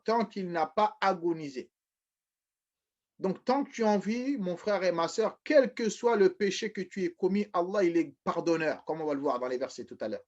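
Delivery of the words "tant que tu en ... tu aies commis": ordinary delivery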